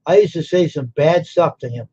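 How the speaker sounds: ordinary talk, nothing marked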